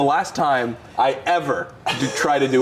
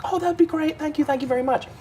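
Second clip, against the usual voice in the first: imitating grateful passenger; 12 semitones higher than usual. Woman thanking driver for the ride, imitated high gratitude. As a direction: quote her gushing thanks up high, lightly.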